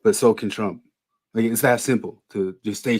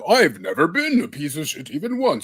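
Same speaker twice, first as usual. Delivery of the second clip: lightly mocking, holier-than-thou; mocking sanctimonious voice; 2 semitones higher than usual. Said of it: "he's like".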